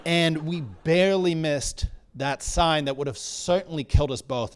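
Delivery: normal talking voice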